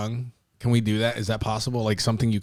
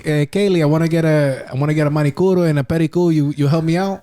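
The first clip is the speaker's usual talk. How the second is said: put-on higher-pitched customer with a Dominican accent; 4 semitones higher, 4 dB louder than usual. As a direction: ask as a hammed-up Dominican client. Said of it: "I could do my accent for my country".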